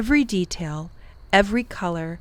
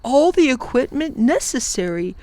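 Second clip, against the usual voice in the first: firm professor; 4 semitones higher than usual. Acted. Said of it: "said firmly".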